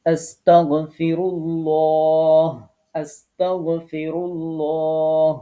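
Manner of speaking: আস্তাগফিরুল্লাহ chanted slowly, ল full and rounded; recitation with heavy lam. Shown as a demonstration. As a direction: recite আস্তাগফিরুল্লাহ in slow tajweed, thick lam. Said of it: "এই ল পড়তে হবে". (ধীরে তিলাওয়াতের সুরে মোটা ল)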